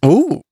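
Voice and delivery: spooky ooh. Pretend. Mock spooky at unlucky thirteen.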